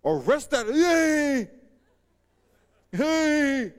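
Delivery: loud, high order turning into a drawn-out yelp; king's startled yell. Acted. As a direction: cry out as your own hand seizes up. King's order as his hand withers.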